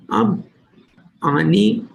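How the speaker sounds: ordinary talk, nothing marked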